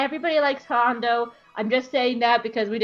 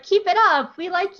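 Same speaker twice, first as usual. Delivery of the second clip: higher, louder mock encouragement; retold cheering; 7 semitones higher, 4 dB louder than usual. Ahsoka encouraging Kallus, mock cheer. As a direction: cheer them on in a light impression.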